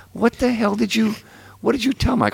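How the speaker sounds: high-pitched, exasperated retold question; incredulous questioning. Man asks the heckler what he told Mike, incredulous. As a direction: act out a high, incredulous demand.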